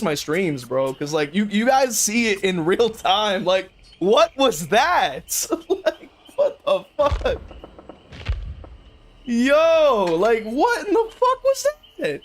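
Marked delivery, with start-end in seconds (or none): 3.4-7.4 high laughing what-was-that; laughing disbelief. Real, not acted. Streamer laughing at his missed hits, laughing disbelief. 9.2-12.2 much higher, stunned what-the-fuck; incredulous outburst. Real, not acted. Streamer stunned by a glitched match, high incredulous outburst. Streamer yelling at bad hit.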